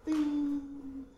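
long, high sung-out "ding"; imitated ding. Preacher imitates the prompt he felt, ringing ding. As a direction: imitate a chime with a drawn-out note. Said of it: "And I just went".

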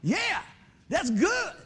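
high, loud delighted grandma voice; grandma cheering her grandson; grandmama's joy. Retold or imitated. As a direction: play a happy old grandmother. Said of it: "Grandmama did".

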